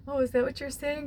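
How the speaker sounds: high, wide-eyed mock surprise; high surprised realization. Asking Spirit through music, high surprised voice. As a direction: lift the voice way up in sudden realization. Retold reaction to song lyric.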